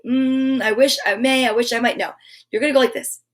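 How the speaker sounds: normal talking voice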